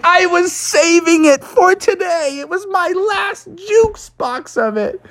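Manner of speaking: very high, drawn-out whine; squeaky, hammed-up protest; upset Froggy. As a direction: wail it like a heartbroken little cartoon frog. Froggy upset his drink was taken.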